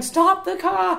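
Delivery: high, loud shouted warning; retold shout. Cyclist shouting at the driver to stop, high and loud. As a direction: yell it like waving down a driver.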